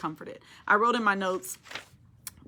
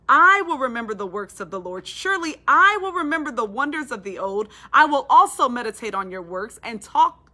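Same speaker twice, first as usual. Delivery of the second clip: building proclamation; 2 semitones higher, 7 dB louder than usual. Real, not acted. Preacher builds to a climax.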